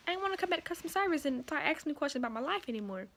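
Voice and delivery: ordinary talk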